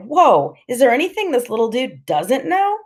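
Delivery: high, wondering question; amazed thought. A narrator's reading. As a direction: let it rise in amazement. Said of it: "And I'm thinking".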